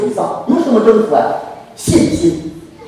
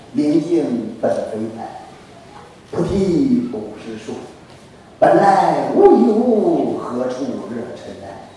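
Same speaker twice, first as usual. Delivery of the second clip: slow verse recitation; 8 semitones lower, 5 dB quieter, much slower than usual. Retold or imitated. Recites Buddhist verse as example.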